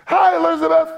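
put-on joyful girl's hello to her cousin; voiced greeting. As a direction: sing out hi like a young girl. Voicing Mary's greeting.

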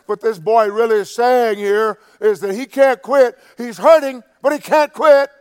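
loud, high, hammering delivery; fervent preaching shout. Real, not acted. Preacher explaining his own outcry to the congregation, fervently shouting. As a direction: drive each phrase home at full volume.

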